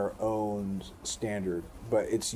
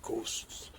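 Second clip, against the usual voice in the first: breathy, drawn-out whisper of Ghosts; imitating her eerie whisper; whispered spooky ghosts; 3 semitones higher, 6 dB quieter than usual. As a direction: hush it like a ghost story. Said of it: "she was saying it kinda like that".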